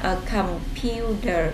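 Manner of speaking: "A computer" slow and spaced; read slowly. Shown as a demonstration. Teacher repeating slowly for the pupils. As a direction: give "A computer" slowly, syllable by syllable. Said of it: "Cô đọc chậm nha".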